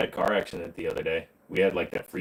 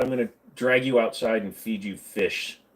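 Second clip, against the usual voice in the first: usual speaking voice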